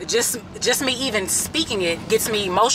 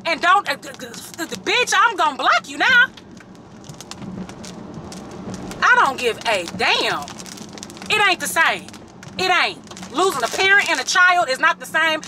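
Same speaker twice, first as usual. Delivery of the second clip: real heated outburst, higher and raised; furious, cutting them off; angry rant, pitch climbing high; real anger; 6 semitones higher than usual. Real, not acted. Ranting at people who compared grief.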